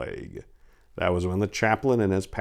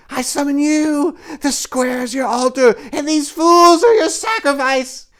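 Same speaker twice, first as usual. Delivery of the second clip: witch yell; 7 dB louder than usual. Acted. Witch calling her devil prince, shrieking. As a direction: call out to her devil lover.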